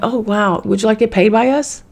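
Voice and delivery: high, quick sugary corporate voice; mock Facebook offer. Facebook suddenly offering her money, mock sweet and high. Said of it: "And they were like".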